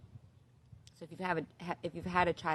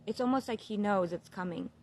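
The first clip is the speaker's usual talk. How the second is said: usual speaking voice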